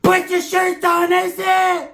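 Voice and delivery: high, slow shouted order; cousin yelling. Retold or imitated. Cousin yells at a rival on the highway, high shout. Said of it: "he'd be like".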